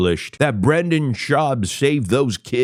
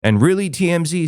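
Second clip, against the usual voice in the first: usual speaking voice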